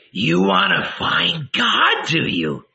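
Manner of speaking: slow, high mocking question; sarcastic. A narrator's reading. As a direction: sneer at his piety. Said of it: "she asked sarcastically".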